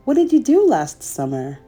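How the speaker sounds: ordinary delivery, nothing marked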